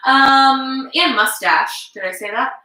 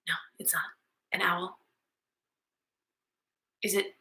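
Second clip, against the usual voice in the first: soft low aside whisper; hushed whisper; 6 semitones lower, 13 dB quieter, faster than usual. Pretend. Teacher playing forgetful, whispering to herself. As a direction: whisper it like a secret. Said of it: "Now I'm doing circle time".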